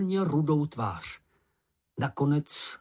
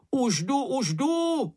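high, eager call; 13 semitones higher, slower than usual. A narrator's reading. Mumínek rushing to the rescue, high call. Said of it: "volal mumínek".

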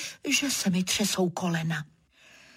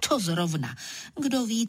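ordinary delivery, nothing marked

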